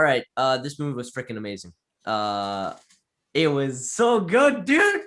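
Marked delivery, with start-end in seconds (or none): 3.3-5.0 slow, high, loud gush ending in a squeak; excited. Real, not acted. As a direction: rave about it, voice jumping up. Gushing about the movie.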